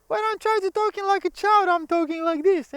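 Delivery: put-on very high falsetto child voice; childlike falsetto. Shown as a demonstration. Teacher explaining falsetto to learners by speaking in childlike falsetto. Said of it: "this is my falsetto voice".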